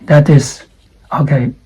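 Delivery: ordinary delivery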